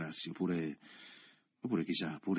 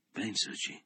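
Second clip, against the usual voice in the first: urging quietly; 4 semitones higher, 3 dB quieter, slower than usual. A narrator's reading. Leo urging softly.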